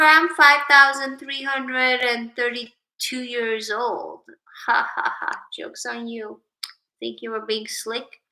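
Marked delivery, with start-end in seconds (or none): none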